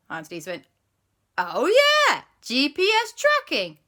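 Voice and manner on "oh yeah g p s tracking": high, loud eager exclaim; excited. Acted. Auntie Lisa tells Gru about tracking, high and excited. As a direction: pipe up thrilled with the answer.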